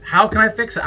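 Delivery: normal talking voice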